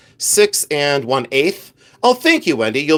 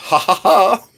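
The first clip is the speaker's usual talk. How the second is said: higher hammed-up "ha ha ha"; fake laugh; 6 semitones higher, 3 dB louder than usual. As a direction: toss in a playful fake laugh. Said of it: "I'm gonna laugh on this one".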